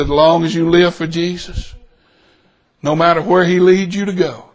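low-pitched, gentle promise; earnest reassurance. Retold or imitated. Customer encourages waitress to live for Jesus, earnest and gentle. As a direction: keep it low and sincere, reassuring her. Tender counsel to waitress, retold.

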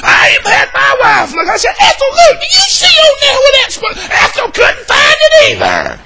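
high, loud, worked-up tattling; hammed-up indignant church member; whiny scandalized complaint; mock complaining deacon.

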